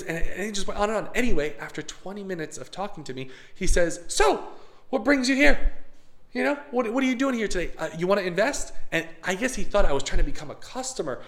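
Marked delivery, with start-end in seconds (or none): 4.1-8.7 boss asking. Retold or imitated. Boss finally asks his purpose.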